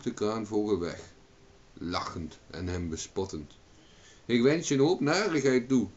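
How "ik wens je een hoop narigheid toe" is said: high, loud curse; angry calling out. A narrator's reading. Owen curses the departing crane, angry loud call. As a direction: call the curse after the bird. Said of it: "riep Owen".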